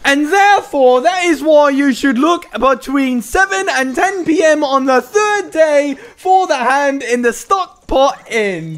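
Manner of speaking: high, slow, mock-British storyteller; goofy author voice. Mocking the friend's long guide reading, goofy author voice. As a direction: ramble pompously in a high goofy voice. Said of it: "It's just a goofy voice".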